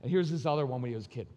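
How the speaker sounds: ordinary talk, nothing marked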